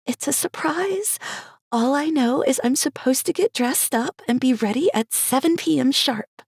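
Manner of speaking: high, hushed excited secret; Cherry's voice. Acted. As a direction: confide it eagerly.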